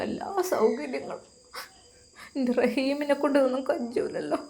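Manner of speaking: put-on an elderly grandma speaking gently from her bed; frail grandmother. Grandmother explains she cannot travel.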